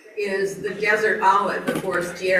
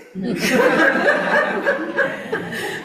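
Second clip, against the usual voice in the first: long, high, loud laugh; real giggling fit, high and loud; burst of laughter; 13 semitones higher, 5 dB louder than usual. Real, not acted. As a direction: crack up helplessly for a few seconds. Amused at tiny cherries, pits.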